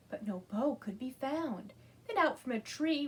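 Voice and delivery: plain reading voice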